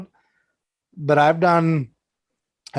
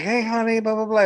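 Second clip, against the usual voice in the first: higher-pitched drag queen sweet-talk; lightly voiced flattering drag queen; chatty drag queen; 6 semitones higher than usual. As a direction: imitate a drag queen talking someone up. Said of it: "talking me up".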